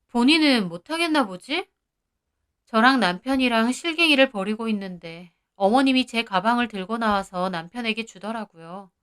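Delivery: usual speaking voice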